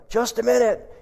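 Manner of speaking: imitating a flustered call to the pastor; retold yell. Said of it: "I yelled".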